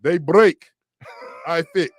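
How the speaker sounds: loud, punchy ad slogan; a radio host loudly imitating a car shop's slogan; commercial voice. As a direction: belt it out like a TV commercial tagline. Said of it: "I'm gonna try to do it in my best voice".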